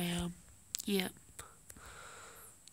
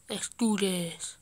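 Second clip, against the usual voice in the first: normal talking voice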